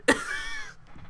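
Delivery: high, loud burst of laughter; real laugh. Real, not acted. Reader losing it at a tragic line, high helpless laugh.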